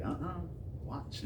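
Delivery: quietly brushing off the wife; hushed refusal. Retold or imitated. Husband quietly refusing his wife, hushed. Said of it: "And I'm like".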